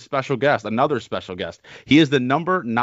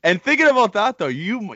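laughing; 7 semitones higher than usual. Real, not acted. Both laugh at dumbest kid.

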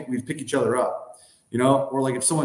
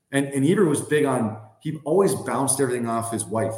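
normal talking voice

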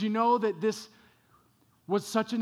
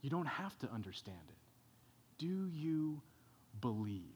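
gentle Jesus; 4 semitones lower, 10 dB quieter than usual. Acted. Jesus's gentle answer to Martha.

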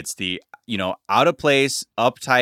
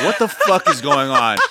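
laughing disbelief; 3 semitones higher, 4 dB louder than usual. Retold or imitated. Retelling reaction again, laughing.

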